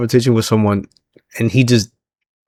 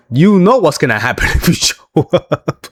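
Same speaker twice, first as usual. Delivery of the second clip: imitated warning that breaks into a laugh; laughing mock threat; 5 semitones higher than usual. Wrestler warning his friend not to show up, retold laughingly. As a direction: start the retold threat higher, then crack up.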